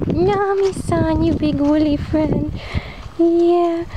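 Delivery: high, slow cooing pet voice; talking to sheep. Real, not acted.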